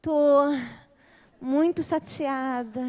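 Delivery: slow, drawn-out, lightly acted sulk; mock flirty pouting. Kidnapped goddess answers Hades' flirting, mock coy pout. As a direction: stretch it out coyly, play-acting. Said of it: "começa a se flertar com ela".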